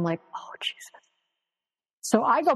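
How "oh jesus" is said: breathy whisper, barely any voice; whispered dread. Retold or imitated. Narrator recalling Oprah's glare, whispering in dread. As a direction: mutter it under your breath in dread. Dismayed aside after Oprah's look.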